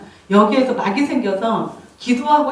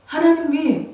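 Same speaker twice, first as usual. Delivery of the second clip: ordinary talk, nothing marked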